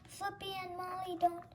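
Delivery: usual speaking voice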